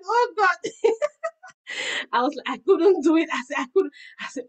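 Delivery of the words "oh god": a high laughing "Oh God"; half-laugh, half-cry of exasperation; exasperated laughing groan; laughing despair. Retold or imitated. Reacting to the Holy Spirit.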